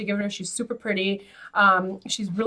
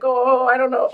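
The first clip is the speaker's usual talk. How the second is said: mock unsure; 3 semitones higher than usual. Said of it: "he's like".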